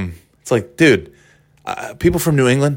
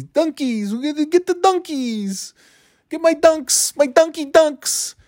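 much higher, slow, put-on Boston local voice; mock New Englander; slower than usual. Mocking New Englanders who call their Dunkin' "donkey dunks", in a high put-on voice. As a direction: lampoon a Dunkies-obsessed New Englander, pitched way up. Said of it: "Well, the New Englander, that's part of their identity".

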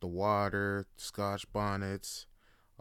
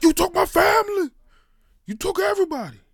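very high, very loud enraged shriek; furious crab scream; 14 dB louder, faster than usual. Retold or imitated. Crab screaming at the boy who boiled his family, furious.

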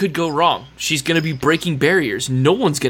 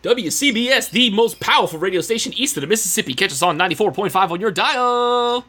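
fast, high hyped radio promo; radio announcer voice; 5 semitones higher than usual. Pretend. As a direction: sell it like a morning DJ.

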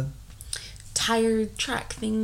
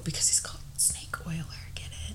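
whispering; 11 dB quieter, faster than usual. Real, not acted. Aside whispered joke.

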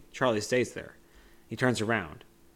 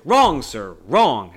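much louder mock yell of wrong; imitating a scream; 13 dB louder, much slower than usual. Retelling Wonka's outburst to a friend, loud mock yelling. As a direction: bark the words out as Wonka losing it. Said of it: "and starts screaming".

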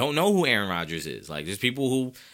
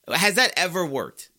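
ranting; 7 semitones higher, 4 dB louder than usual. Real, not acted. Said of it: "I know I'm ranting today".

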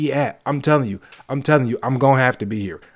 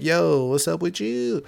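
nosy coworker; 5 semitones higher, 3 dB quieter, slower than usual. Retold or imitated. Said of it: "they be like".

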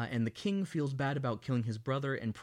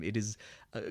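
ordinary delivery

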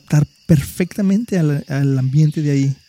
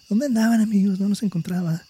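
goat voice; 6 semitones higher, 6 dB quieter than usual. Acted. Voicing the goat returning.

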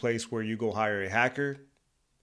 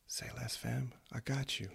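breathy secret whisper; 14 dB quieter than usual. Acted. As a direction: lean in and whisper it. Whispered aside to the listener.

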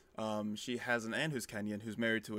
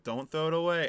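higher-pitched mock plea; playful warning; 5 semitones higher, 4 dB louder than usual. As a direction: lightly plead with a toddler. Retold playful warning to toddler.

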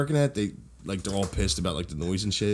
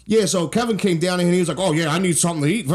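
imitating a Maine accent, slightly higher; 6 semitones higher, 3 dB louder than usual. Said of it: "like, the accent".

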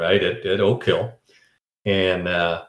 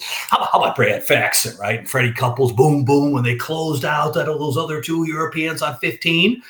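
insistent mock-frustrated quizzing, climbing higher; frustrated boss; 7 semitones higher than usual. Boss quizzes him about Freddie Couples, getting frustrated. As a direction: build from insistent to exasperated, pitch rising. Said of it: "he's starting to get frustrated".

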